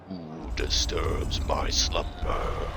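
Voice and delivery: slow, booming cave guardian voice; grinding stone statue. Pretend. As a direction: rumble it out like an ancient stone head. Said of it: "Be like the cave in Aladdin".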